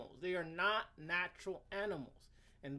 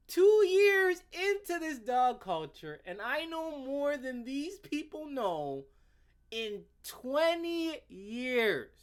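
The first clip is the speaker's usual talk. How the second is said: much higher, louder, slow mocking outrage; mock incredulous; 9 semitones higher, 6 dB louder, slower than usual. Mocking the anti-pit-bull writer, high and outraged. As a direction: ham up the disbelief, stretched out.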